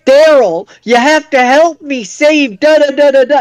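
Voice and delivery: high-pitched goofy dinosaur voice; goofy, pleading cartoon voice, pitched well up; Barney. Realistic dinosaur begs the hero for help, goofy Barney voice. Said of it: "with a goofy voice is like".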